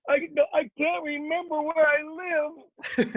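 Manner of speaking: put-on high, slow, muddled old-timer; dotty old man losing his thread; confused old man. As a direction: play the forgetful old man, drawn out. Old man's punchline in joke.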